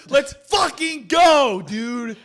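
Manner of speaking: high, drawn-out victory screams; hyped shouting. Real, not acted. Podcaster hearing his friend say Mario his way, hyped shouting. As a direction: yell it like your team just scored. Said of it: "We just got so hyped".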